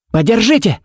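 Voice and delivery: sharp high barked command; barked order. A narrator's reading. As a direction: bark it at the sheriff. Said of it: "рявкнул он". (рявкнуть приказ)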